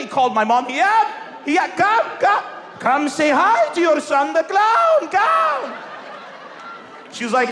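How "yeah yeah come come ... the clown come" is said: hammed-up dad summoning mom; mock calling out. Dad calling mom to mock his son, hammed-up shout.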